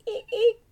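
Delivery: very high squeaky dolphin eek; dolphin squeak. Pretend.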